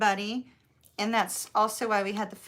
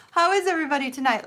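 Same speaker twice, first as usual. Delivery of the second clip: laughing greeting; 5 semitones higher, 6 dB louder, much faster than usual. Real, not acted.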